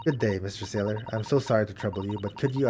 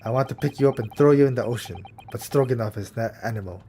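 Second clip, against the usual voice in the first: put-on lightly Russian-accented gruff crewman; proud Russian sailor, a touch louder; mild Russian accent, stiff and proud; 4 dB louder than usual.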